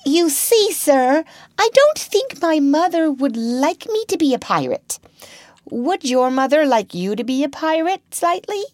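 put-on high, careful boyish voice; a timid boy picking his words with care; small boy being diplomatic to a pirate captain; prudent little boy. A narrator's reading. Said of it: "So Toodles explained prudently".

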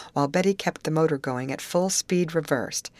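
usual speaking voice

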